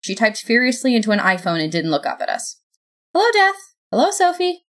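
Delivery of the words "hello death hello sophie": high, perfunctory greeting; harried God. A narrator's reading. God greets Death and Sophie over her phone, harried. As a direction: greet them in a high, busy voice.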